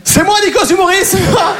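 high, wailing insistence; mock desperate protest. Stoned comedian insisting on his identity to police, high desperate protest. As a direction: squeal it in comic distress.

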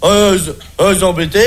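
halting, effortful stutter full of blocks; stammerer. Acted. Comedian tells a joke about a stutterer, stammering all through. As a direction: stumble and stall on nearly every word. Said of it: "c'est un bègue qui".

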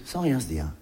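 ordinary talk, nothing marked

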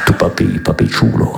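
low whispered mock Spanish; hushed fake Spanish with a reggaeton feel; reggaeton Vader. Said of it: "dans la langue du reggaeton".

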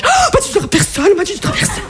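put-on high excited gossiping girl voice; high mock gossip, fading off; eager gossip. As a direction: squeal it high like you're dying to spread it.

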